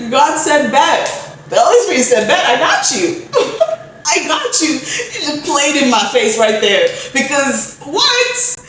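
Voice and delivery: high, giggly retelling; laughing in disbelief at your own bad luck. Real, not acted. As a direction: tell it while cracking up. Laughing at the joke on herself.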